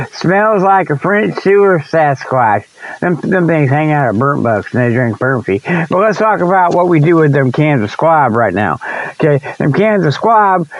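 ordinary talk

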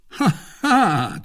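high, scornful ha ha; triumphant jeering laugh; mocking laugh. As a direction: laugh at her failure. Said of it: "cried Lord de Winter".

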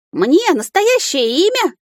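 очень высокий, растянутый изумлённый вопрос; ахни, не веря своему счастью; изумлённый домовой. A narrator's reading. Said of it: "Домовой вытаращился". (изумлённо, медленно и высоко)

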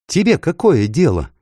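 high, sharp retort; exclaimed. A narrator's reading. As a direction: exclaim it indignantly, pitch jumping up. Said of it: "воскликнул странный Тархан".

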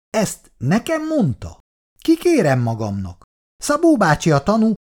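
high, flustered protest; nervous indignation. A narrator's reading. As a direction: protest as if mortally insulted. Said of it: "Ádám csutkája lefölmozgott idegességében". (felháborodott, ideges tiltakozás)

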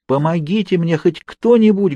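high desperate shout for help; loud cry for help. A narrator's reading. Trapped woman shouting for anyone to help. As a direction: call out for help through the walls. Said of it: "громко кричала неизвестная".

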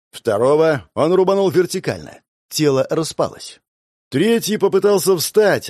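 plain reading voice

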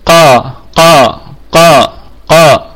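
teacher's model قا with a full uvular ق; qaf demonstration. Shown as a demonstration. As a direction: drill قا slowly from the back of the throat. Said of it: "أقول مثلًا".

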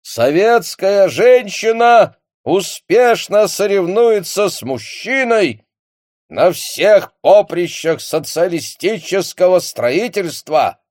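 loud, slow, high solemn radio speech; Marshal Voroshilov. Acted. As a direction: boom it out like a Soviet broadcast. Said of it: "торжественный голос маршала Ворошилова".

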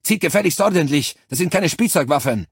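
loud, high barked reprimand; angry scolding. A narrator's reading. Cortez scolds the troops for wild shooting, loud angry shouting. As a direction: chew the soldiers out.

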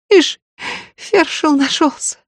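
put-on high, sneering girl in pain; mock the would-be healer, aching; scornful pained girl. Toothache girl mocks Ustya.

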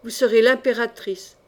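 usual speaking voice

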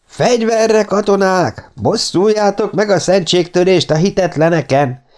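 rally the soldiers with a furious shout; shouting to arms. A narrator's reading. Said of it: "kiabálta". (a szerzetes harsányan fegyverbe hívja a katonákat)

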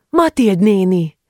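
calling out. A narrator's reading. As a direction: call out to the aunt across the yard. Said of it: "kiáltotta".

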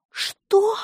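high, stunned exclamation; astonished. A narrator's reading. Nurse hears the doctor threaten a complaint, astonished. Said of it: "так удивилась, что чуть в обморок не упала".

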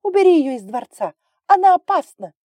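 very high, loud screech; shrieking. A narrator's reading. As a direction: build to a piercing squeal. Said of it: "завизжала драконица". (визгливо, очень громко и высоко)

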